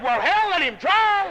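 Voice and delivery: high, loud and slow; booming punchline. Retold or imitated. The superintendent speaks up for the boy, high, loud and slow. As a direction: let it ring out big and slow.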